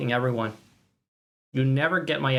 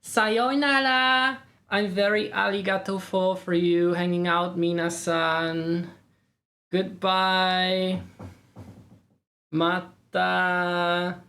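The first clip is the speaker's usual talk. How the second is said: put-on high cutesy anime girl voice; squeaky kawaii weeb farewell; breathy cutesy giggly; 5 semitones higher, much slower than usual. Anime-style cutesy streamer goodbye.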